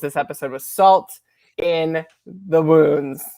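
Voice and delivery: slow down and set "salt" apart; "in", "the", "wounds" dealt out slowly with pauses; drawn-out emphasis. Real, not acted. Panelist summing up this week's episode, slow weighty emphasis on "salt in the wounds". Repeats the phrase for dramatic emphasis.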